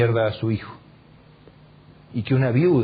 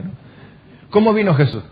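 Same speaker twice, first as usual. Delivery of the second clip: ordinary delivery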